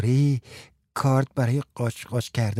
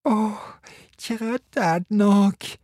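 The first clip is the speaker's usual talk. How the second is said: higher, whiny, self-pitying voice; whining with a lump in throat; 8 semitones higher than usual. Acted. Frans moaning to Martha, higher and whiny. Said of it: "با بغض و ناله گفت".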